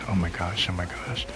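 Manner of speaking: soft, repeated retold disbelief; hushed dismay. Telling how it was setting in at the station, hushed dismay. Acted quote as reality sets in.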